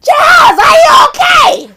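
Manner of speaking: very high, loud frantic yell; mock shout. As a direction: scream it out, the opposite of whispering. Corrected take after whisper complaint.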